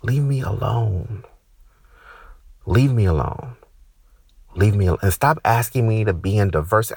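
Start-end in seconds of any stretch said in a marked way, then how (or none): none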